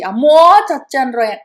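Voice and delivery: loud anguished cry; loud wail. Acted. Kunti hurrying over, loud despairing cry. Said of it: "గట్టిగా వికృస్య".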